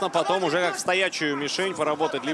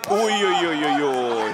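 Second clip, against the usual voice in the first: wincing oh-oh-oh; 4 dB louder, slower than usual. Real, not acted. Commentator reacts to a hit.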